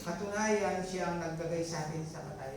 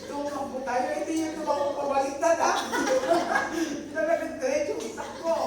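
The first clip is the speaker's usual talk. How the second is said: louder and higher, pushing the point; excited urging; 8 semitones higher, 8 dB louder than usual. Real, not acted. Preacher urging the men in the congregation to flee, loud and high. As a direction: urge them hard to run away.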